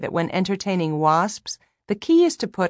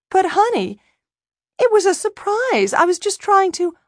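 much higher, pleading wife; 14 semitones higher, 6 dB louder than usual. A narrator's reading. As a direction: whine it up high, defensive. Wife pleading with angry husband.

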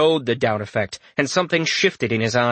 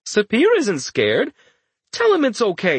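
higher, patronising sing-song; talking to a little kid; 10 semitones higher than usual. Acted. Richter talking down to his friend, high and patronising. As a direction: coax him like a small child. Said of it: "like he was talking to a little kid".

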